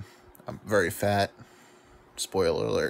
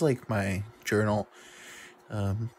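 ordinary talk

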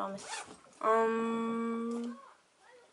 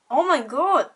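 startled exclaim; 6 semitones higher, 8 dB louder than usual. Real, not acted. Sudden hair in her nose.

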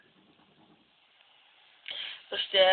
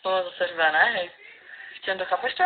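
ordinary talk

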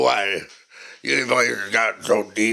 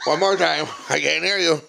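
normal talking voice

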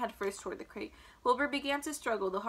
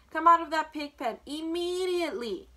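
loud, high motherly order; scolding storybook shout; cried out; 5 semitones higher, 7 dB louder than usual. A narrator's reading. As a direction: stretch and punch IMMEDIATELY as an order. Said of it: "cried Missus Arable".

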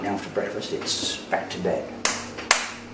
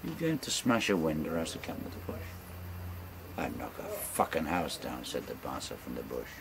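rolling bush-poem recital, relishing every line; ballad recitation; 5 semitones lower than usual. Acted. Storyteller reciting a bawdy ballad to friends, in a rolling recital voice.

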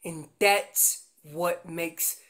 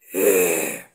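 low growl from the vocal cords; 7 dB louder than usual. Shown as a demonstration. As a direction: growl the eh deep in the throat. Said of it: "I'm growling with my voice".

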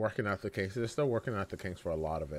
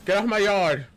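yelling; 9 semitones higher, 7 dB louder, faster than usual. Acted. Yelling at raiders again.

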